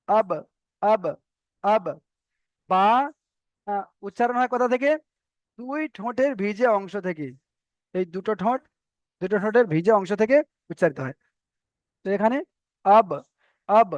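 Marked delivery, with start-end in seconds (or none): 0.1-1.9 আব with the ba bounced off the lips; qalqalah echo. Shown as a demonstration. Teacher demonstrates qalqalah to students, আব with a bouncing ba. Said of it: "এমন ভাবে উচ্চারণ হবে". 12.9-13.9 আব with a bounced, released ba; qalqalah echo. Shown as a demonstration. Teacher shows students how it should sound, bouncing ba on আব. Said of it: "এমন ভাবে উচ্চারণ হবে".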